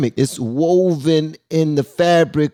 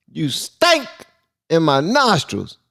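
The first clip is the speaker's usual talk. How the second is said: excited; 5 semitones higher, slower than usual. Real, not acted. Gleefully repeats the clip's line.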